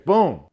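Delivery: explosive loud "boom"; shouted door slam. Retold or imitated.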